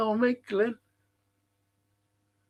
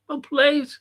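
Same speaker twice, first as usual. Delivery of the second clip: high, loud burst of praise; excited praise; 10 semitones higher, 4 dB louder than usual. Real, not acted. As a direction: shout it out as the move lands. Cheering a good move.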